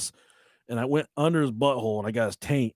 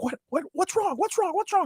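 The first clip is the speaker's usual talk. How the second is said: much higher, worried mother asking fast; alarmed mom. Retold or imitated. Mom hears the kid yelling and asks what's wrong. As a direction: play the startled mom. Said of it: "she's like".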